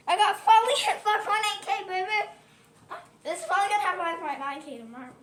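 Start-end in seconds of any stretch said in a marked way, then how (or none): 0.1-2.3 loud, high, thrilled whoop; real excitement, almost crying with joy; excited cheer. Real, not acted. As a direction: shout it out like you just won. Celebrating hitting 5.8K subscribers.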